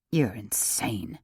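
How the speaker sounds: low, soft, slow mutter under the breath. A narrator's reading. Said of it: "he muttered".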